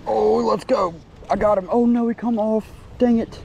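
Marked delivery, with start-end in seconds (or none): none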